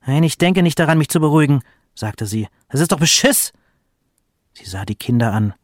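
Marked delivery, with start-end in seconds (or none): 0.1-1.6 higher, indignant refusal; losing her temper. A narrator's reading. Mother rebuffs her husband's calming, losing her temper. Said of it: "die Mutter plötzlich ausrastet". 2.7-3.5 higher, indignant outburst; losing her temper. A narrator's reading. Mother protests she is being cheated, losing her temper. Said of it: "die Mutter plötzlich ausrastet".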